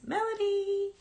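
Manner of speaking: high, carrying call across the house; calling the dog. Real, not acted. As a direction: call her name out to find her. Calling her dog from the room.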